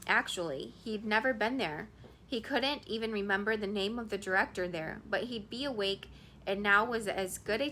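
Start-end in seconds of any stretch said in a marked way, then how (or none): none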